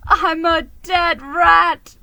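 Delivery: slow, loud, wailing sob; sobbing. Acted. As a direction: cry it out like all is lost. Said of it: "he sobbed".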